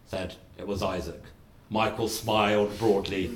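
ordinary delivery

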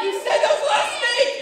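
extremely high, loud squealed protest; screeching mock panic; hysterical accusation. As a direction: shriek it like a brother at the end of his wits. Brother accuses again, more frantic.

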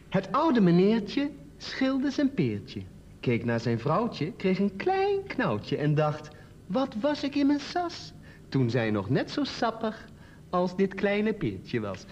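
slow, measured verse reading; poem recital. Acted. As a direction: draw out each line, pausing between them. Reads own poem aloud.

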